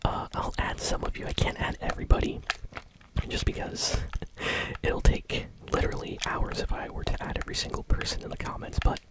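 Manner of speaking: steady ASMR whisper. Real, not acted. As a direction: whisper everything close to the mic. ASMR gaming whisper throughout.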